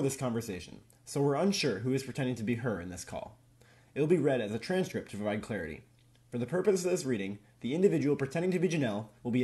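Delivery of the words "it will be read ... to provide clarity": ordinary delivery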